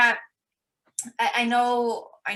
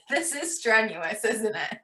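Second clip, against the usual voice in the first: laughing. Real, not acted. Laughs watching guest eat gel.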